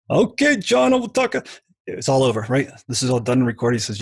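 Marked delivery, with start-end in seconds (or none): 0.1-1.3 put-on much higher, light English-accented reply; mock English accent. Guest quoting his narrator's agreement in a high mock English accent. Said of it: "he's got this English accent".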